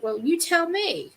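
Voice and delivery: high, drawn-out comeback; retold indignant retort.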